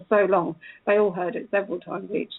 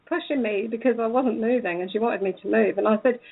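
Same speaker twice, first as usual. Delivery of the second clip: ordinary talk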